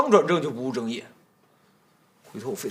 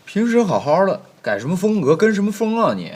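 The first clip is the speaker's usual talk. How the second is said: exasperated scolding; 6 semitones higher, 3 dB louder than usual. Acted. Master scolds maid's act.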